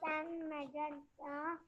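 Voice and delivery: very faint, hard to hear; speaking far too softly; barely audible. Real, not acted. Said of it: "your voice is very low".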